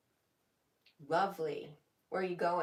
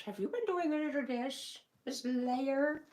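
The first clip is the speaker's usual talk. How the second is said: playful teasing; 8 semitones higher than usual. Pretend. Teasing a viewer about "layer" typo.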